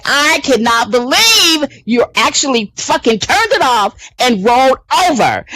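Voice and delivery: retold outrage. Telling off the co-host who rolled over, retold outrage. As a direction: act out going crazy on him. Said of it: "I just went crazy".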